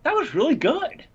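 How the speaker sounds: high, surprised "that was really good"; impressed surprise. Retold or imitated. Buddy who mocks Kiss admits the song was good, surprised. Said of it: "he's like".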